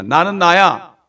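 booming divine "I am who I am"; God's declaration. Acted. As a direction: proclaim it boldly as God. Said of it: "하나님 그렇게 말씀하십니다".

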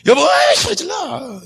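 high, drawn-out mock screech; wife yelling back. Shown as a demonstration. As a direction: shriek at the husband as a bad example. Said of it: "이러면 안 돼". (남편에게 꽥 지르는 흉내)

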